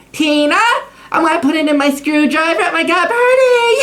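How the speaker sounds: put-on high, whiny party girl. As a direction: whine it like a tipsy party girl. Mocking a cheap bourbon drinker.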